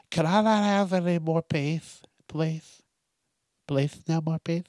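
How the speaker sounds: put-on whiny kid Billy. Podcaster voicing Billy begging to skip peas, whiny child. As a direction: beg like a little kid who hates peas. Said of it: "maybe I'm Billy".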